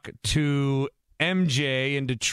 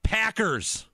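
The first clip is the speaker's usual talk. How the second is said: Packers said high, with a falling finish; a fan proudly naming his team; excited pick; 7 semitones higher than usual. Real, not acted. Host admits homer bias, excitedly names Packers.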